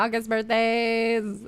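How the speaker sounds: usual speaking voice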